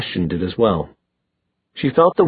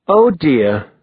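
dripping with sarcasm, higher and louder; mock dismay, heavy irony; sarcastic; 5 semitones higher, 4 dB louder than usual. A narrator's reading. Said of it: "his voice dripping sarcasm".

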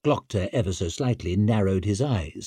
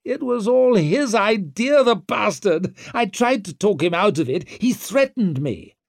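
high-pitched, frantic blame-shifting; indignant prisoner wriggling out of it; Wetterland, panicked excuse; 11 semitones higher, 4 dB louder than usual. Acted. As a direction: blurt excuses in a raised voice.

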